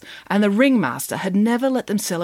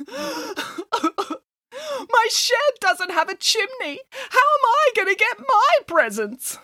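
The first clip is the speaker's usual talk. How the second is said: blubbering. A narrator's reading. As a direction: whimper like a heartbroken bear. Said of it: "blubbered Boris".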